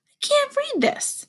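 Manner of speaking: put-on high, slow little-girl voice; a high, drawn-out girl's voice for Kayla; Kayla's voice. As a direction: read it as a puzzled young girl. Kayla puzzled by the letter.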